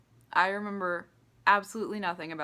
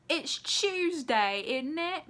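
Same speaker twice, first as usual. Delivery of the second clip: slow, much higher mock Irish accent; 8 semitones higher, 3 dB louder, much slower than usual. Vlogger mocking Brad Pitt's Irish accent, high and slow Irish. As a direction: drag out a silly Irish accent like the meme. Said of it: "Brad Pitt's Irish accent".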